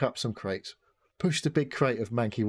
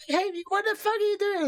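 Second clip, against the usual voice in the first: high-pitched yelling pedestrian; mock angry yell; 4 dB louder than usual. Angry pedestrians at a bad driver, mock high yell. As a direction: yell it like an outraged passer-by, far above your usual pitch. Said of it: "They all start yelling at you".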